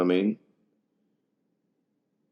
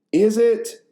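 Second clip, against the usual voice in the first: mocking squeak; 12 semitones higher, 4 dB louder than usual.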